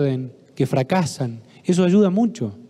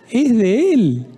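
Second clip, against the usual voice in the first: higher and louder, mocking disbelief; ironic repeat; 5 semitones higher, 4 dB louder, much slower than usual. Therapist mocking the mother's excuse to the audience, ironic. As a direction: echo her words as if they're absurd.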